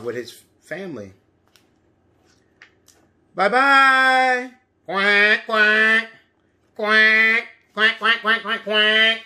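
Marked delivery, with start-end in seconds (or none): 3.3-4.5 higher, loud sing-song goodbye; high bye-bye. Pretend. Duckling says goodbye. 4.9-9.2 higher, loud quacks; duck quacking. Pretend. Ducks voiced in story.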